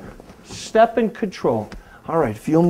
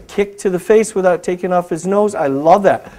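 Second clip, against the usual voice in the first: usual speaking voice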